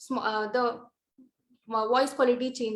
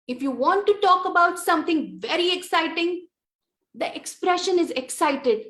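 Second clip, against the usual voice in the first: higher and louder, bright with excitement; excited; 5 semitones higher, 4 dB louder than usual. Shown as a demonstration. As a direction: lift the voice as if sharing thrilling news. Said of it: "Did you see the change in the voice?".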